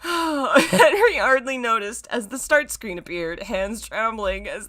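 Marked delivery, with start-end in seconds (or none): 0.0-3.4 laughing. Real, not acted. Still recovering from laughter.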